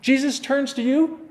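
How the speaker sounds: normal talking voice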